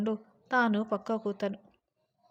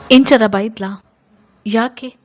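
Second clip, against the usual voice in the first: shocked outburst; 15 dB louder than usual. A narrator's reading. Husband shocked at sister's scolding.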